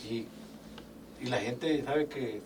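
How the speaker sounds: normal talking voice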